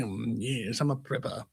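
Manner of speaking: low, soft put-on drone. Claiming to be a prepper, put-on low voice. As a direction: stay down in the put-on voice. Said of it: "I'm gonna talk like this".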